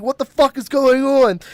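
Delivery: mock yell of a pissed off friend; retold angry yell. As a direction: shout it like he's furious. Angry friend yelling in retold scene.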